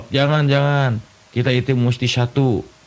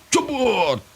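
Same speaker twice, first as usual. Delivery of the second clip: sharp, much higher mock crash noise; crash sound; 11 semitones higher than usual. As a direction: blurt the crash like a sound effect. (tiruan suara tabrakan)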